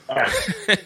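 high, sudden laugh; short amused laugh; burst of laughter. Real, not acted. Laughs at the script line.

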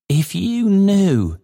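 high, slow, earnest appeal; earnest Trotty. A narrator's reading. Trotty appeals to the bells, clasping hands, earnest. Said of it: "clasping his hands earnestly".

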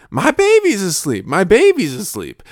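put-on high excited kid shout; a small boy yells proudly about his sleeping brother; child shouting. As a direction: blurt it out loud in church like a toddler. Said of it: "started shouting".

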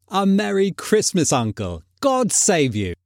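high-starting cheerful greeting; cheerful nephew's hearty hello. A narrator's reading. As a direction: call out a cheery holiday greeting. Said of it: "cried a cheerful voice".

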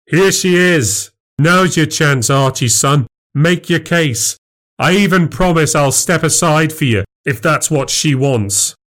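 high, mock-gracious taunt; sarcastic challenge. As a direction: dare the rival to make his move. Husband taunts rival before wife.

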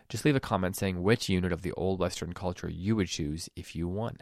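low, soft, measured sponsor read; podcast ad read. Acted. Host to podcast listeners about a giveaway, ad read. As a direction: read the sponsor message evenly and quietly.

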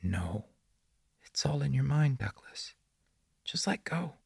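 put-on whispery, high child voice; prepubescent boy. A narrator's reading. The boy in the woods praises Douglas, in an eerie childlike whisper. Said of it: "his phlegmy, prepubescent voice".

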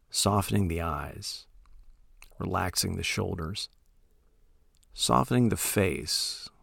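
soft, unhurried meditation voice with long pauses; calm, hushed instruction, letting each phrase trail into silence; guided meditation. Acted. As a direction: gently guide listeners into settling, phrase by phrase.

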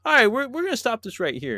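high, loud mock interruption of the pitch; mock owner cutting in. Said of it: "And he's like".

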